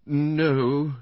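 high, drawn-out reluctant no. A narrator's reading. Wife admitting she doesn't know the man, reluctant. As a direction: let the denial out reluctantly. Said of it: "said Missus Bland with obvious reluctance".

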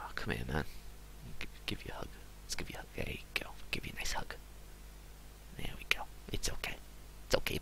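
soft, whispered comfort; tender whisper. Acted. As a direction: whisper to soothe a crying friend. Comforting character with a hug.

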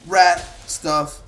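ordinary talk, nothing marked

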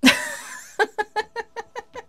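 a high, giddy burst of laughter. Real, not acted. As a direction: laugh from pure delight. Laugh of joy.

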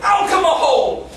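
loud, slow, high shout of alcohol; shouted word. Acted. Comic host bellowing alcohol at the crowd, loud and slow.

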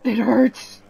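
pained ghost voice. Acted. Voicing ghost in pain.

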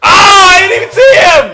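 loud, high outburst; startled yell. Real, not acted. Player caught by unseen enemy, startled yell. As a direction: yell in alarm at the game. Hit by surprise in game.

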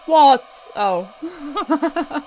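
a loud shocked what, laughing; jolted, then laugh it off; startled laugh. Real, not acted. Player jumps at a sudden figure, shocked laughing.

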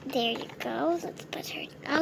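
soft unvoiced whisper. Whispering while putting the patient to bed. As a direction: hush so she can rest.

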